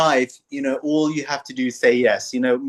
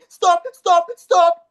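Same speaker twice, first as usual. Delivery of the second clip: high, loud mock protest; baby shouting stop; 6 dB louder, much slower than usual. Newborn yelling at the doctor to stop, high and loud. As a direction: yell it like an outraged newborn.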